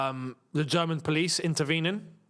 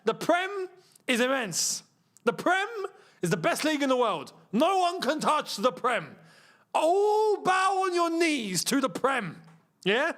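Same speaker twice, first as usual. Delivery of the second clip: mock grandiose proclamation; 6 semitones higher than usual. Fan demands worship of the Prem, mock grandiose proclamation. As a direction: proclaim it like a hammed-up herald.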